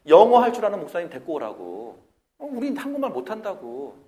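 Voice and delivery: loud demanding retort; insisting students. Retold or imitated. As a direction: demand it stubbornly. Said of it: "애들이 주장합니다".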